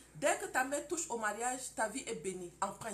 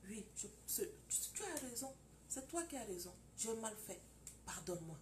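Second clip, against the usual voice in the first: much softer, humble apology; contrite wife; 9 dB quieter than usual. Acted. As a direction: apologize quietly, head bowed. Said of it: "Ma femme ne parle plus quand je crie". (épouse repentante, voix très douce)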